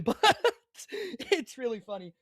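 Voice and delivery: giggling through the words; laughing. Real, not acted. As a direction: let the laugh spill into it. Laughing with production.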